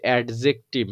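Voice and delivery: অ্যাডজেক্টিভ with every letter sounded, D included; spelling pronunciation with D. Shown as a demonstration. Teacher showing how spelling misleads, অ্যাডজেক্টিভ with D sounded. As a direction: read অ্যাডজেক্টিভ as spelt, voicing the D. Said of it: "উচ্চারণ দেখে মনে হতে পারে".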